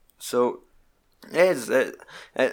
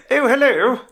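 high, loud put-on British hello; mock British accent; 12 semitones higher, 6 dB louder than usual. Film dad picking up the phone, mock British. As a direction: answer the phone in hammy British. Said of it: "Hey, fuck, British?".

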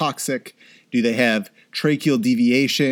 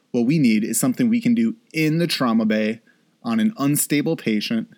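ordinary delivery

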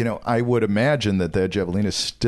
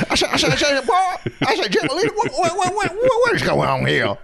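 put-on high laughing Tennessee accent; a playful Tennessee accent. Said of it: "your Tennessee voice".

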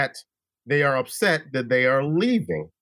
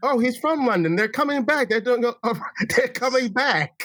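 high-pitched mock London accent; terrible British accent; 7 semitones higher than usual. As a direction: badly fake a Londoner, pitched up. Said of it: "You need to keep doing that accent".

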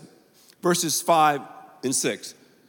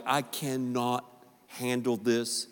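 slow, soft, exhausted sigh of a line; worn-out mom; 7 semitones lower, 9 dB quieter, slower than usual. Retold or imitated. Mom at the end of her fuse with the kids, soft and slow. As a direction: mutter like a mom out of patience. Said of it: "You're like".

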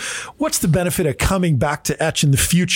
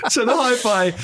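laughing; 4 semitones higher than usual. Real, not acted. Laughing after old voice joke.

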